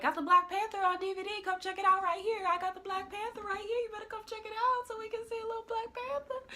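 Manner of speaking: high, fast bootleg seller's pitch; street hawker. Pretend. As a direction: sing out your wares like a hawker. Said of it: "He should have been like".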